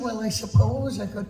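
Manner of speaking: high cartoon bear voice; Winnie the Pooh. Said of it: "give me some poo".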